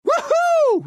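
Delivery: high, loud "woohoo"; whooping cheer. Acted. Star-Lord enjoying the fight, high cheering whoop. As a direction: let out a triumphant high whoop.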